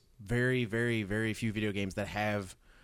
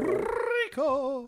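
mock squeal; 4 dB louder than usual. Mock echo.

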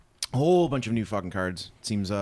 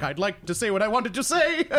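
mock Key and Peele singer; 8 semitones higher than usual. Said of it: "and he's like".

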